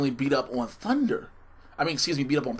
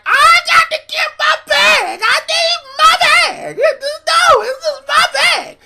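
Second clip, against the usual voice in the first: very high, loud shrieking mother voice; Martin's mama voice; 13 dB louder, slower than usual. Retold or imitated. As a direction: shriek it like a frantic sitcom mom. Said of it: "made Lynn sound like Martin's mama".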